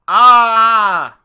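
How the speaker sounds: loud hammed-up pained cry; a fox faking agony, loud; fake moan. Reading a fairy tale, the fox moans loudly in fake pain. Fox fakes moaning in story.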